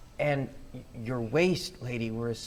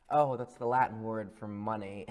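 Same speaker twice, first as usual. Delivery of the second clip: ordinary talk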